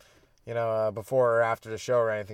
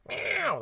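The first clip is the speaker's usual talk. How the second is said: high mock cat meow; a squeaky little meow for the cats; 3 dB quieter than usual. Comic meowing to a cat audience.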